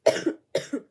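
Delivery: real coughing fit; harsh cough. Real, not acted. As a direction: cough hard, out of breath. Coughing after laughing fit.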